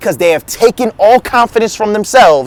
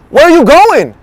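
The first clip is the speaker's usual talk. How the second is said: higher-pitched, puzzled interruption as Peter; blurted question; 6 semitones higher, 4 dB louder than usual. Acted. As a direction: blurt it out, voice jumping up.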